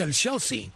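teasing imitation of native-language "Chelsea"; imitated native pronunciation. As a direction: repeat "Chelsea" as a put-on foreign name. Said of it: "él pronuncia en el idioma".

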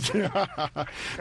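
amused chuckle; laugh. Real, not acted. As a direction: laugh along at the banter. Banter over pronunciation.

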